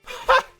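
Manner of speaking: loud, high burst of a laugh; one sharp, high "ha" of disbelief; startled high laugh. Real, not acted. Player stunned by the game's news, startled loud laugh.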